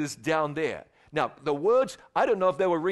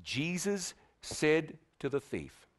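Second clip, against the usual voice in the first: ordinary talk, nothing marked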